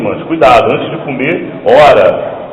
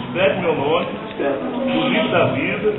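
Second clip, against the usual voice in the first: soft prayer lead; 12 dB quieter than usual. Real, not acted. Pastor leading new convert in quiet prayer. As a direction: guide her gently into prayer. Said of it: "leads the woman's prayer".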